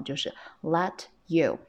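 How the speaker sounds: ordinary delivery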